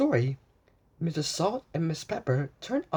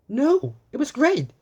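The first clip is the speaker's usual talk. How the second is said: excited; 9 semitones higher, 6 dB louder than usual. A narrator's reading. Blue excitedly tells Magenta.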